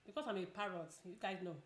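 ordinary delivery

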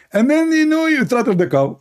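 high-pitched, emphatic put-on voice; insistent, high mock-divine instructions; Allah. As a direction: hand down orders in a comic high voice. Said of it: "Allah, he answered".

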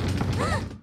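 a quick startled intake of breath; surprised gasp. Acted. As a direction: catch your breath, startled. Knock at the door.

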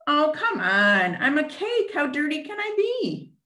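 high whiny cake protesting bath; squeaky cake who hates baths; rude cake voice. Acted. Puppet cake whining to the teacher about baths.

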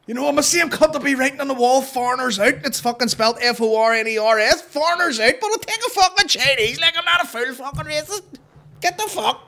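high, loud ranting caricature; mock bigot voice. Keeps mocking the protester's voice.

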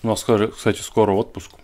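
speaking between bites; chewing while talking. Real, not acted. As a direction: mouth partly full of food. Seen 0: Right-hand man bites dumpling before, cheek bulges between phrases.

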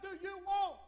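high, strained mock yell; high-pitched, exasperated holler; imitated scream back. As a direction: imitate a wife yelling back from another room. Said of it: "she'd scream just as loud as me".

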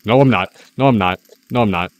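loud panic easing off; shout, then calm down each time; panicked shout fading down. Real, not acted.

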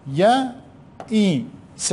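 يئس stretched very long; very slow dictation. Shown as a demonstration. Teacher dictating to pupils, يئس very drawn out. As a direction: drag out يئس for the class. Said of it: "teacher dictating very slowly".

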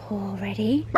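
ordinary delivery

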